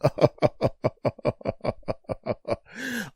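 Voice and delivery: drawn-out maniacal laugh; Joker cackle. Acted. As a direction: keep the villain laugh going. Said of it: "laughing like the damn Joker".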